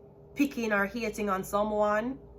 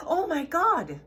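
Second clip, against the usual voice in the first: mock gasp; 7 semitones higher, slower than usual. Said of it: "she like".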